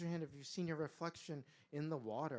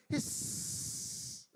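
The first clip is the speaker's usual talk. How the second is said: long, drawn-out snake hiss; serpent hiss. A narrator's reading. Serpent warns after being stepped on, hissing. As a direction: hold the final S in a sustained hiss. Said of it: "The serpent gave a loud".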